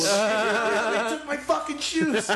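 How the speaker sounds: high, loud and slow, a wail of panic; mock panic. Teen realising his shoes are gone, high slow panicked wail.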